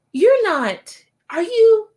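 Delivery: high, loud starstruck imitation; amazed disbelief. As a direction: act out a stunned fan spotting someone. Retells stranger's amazed recognition at Starbucks.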